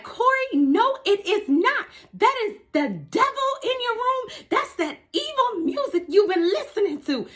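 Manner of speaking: high-pitched mock yelling mother; shrill churchy mom scolding her grandson. Grandma scolding grandson about the devil, high mock yell. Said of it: "She yelling".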